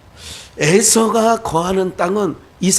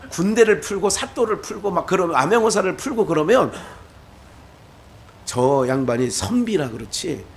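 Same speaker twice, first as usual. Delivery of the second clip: ordinary talk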